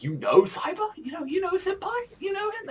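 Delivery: very high, excited squeal; mock squeak. Erina asking Azumi about her idol, squealing. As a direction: gush like a starstruck fan. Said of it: "Erina is like".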